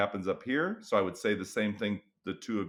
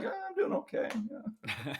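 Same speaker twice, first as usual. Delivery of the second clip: high, thin, deflated answer; weary mock reply; 12 semitones higher, 5 dB quieter, slower than usual. Worn-down teacher by September.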